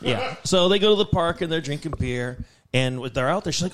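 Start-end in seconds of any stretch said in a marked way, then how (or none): none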